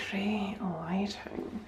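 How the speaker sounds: slow, soft breathy aside; hushed little compliment; whisper. Real, not acted. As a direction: whisper it to the camera.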